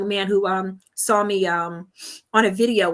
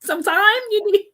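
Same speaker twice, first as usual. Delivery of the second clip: high, slow, over-concerned fretting with a laugh; fussy worried friend, laughing; mock worried friend; 11 semitones higher, 4 dB louder than usual. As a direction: ham up a panicking friend fussing over her. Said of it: "he was, like, devastated".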